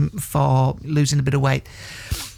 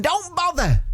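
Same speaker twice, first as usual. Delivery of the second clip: high-pitched mock quote, lightly voiced; relayed spirit message; 12 semitones higher, slower than usual. Psychic passing on the dead cake man's advice, high relayed quote. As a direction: pass it on in a raised, emphatic voice. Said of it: "he's saying".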